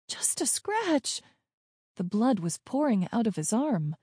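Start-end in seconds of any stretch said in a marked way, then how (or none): 0.1-1.2 high, slow, disbelieving question; incredulous echo. A narrator's reading. Child doubting Dad's bleeding is minor, incredulous echo. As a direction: repeat it back in disbelief.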